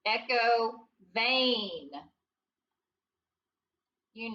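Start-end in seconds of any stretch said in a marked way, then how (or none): 0.1-1.9 "Echo vein" said very slowly for the class to repeat; slow model. Shown as a demonstration. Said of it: "echo these words".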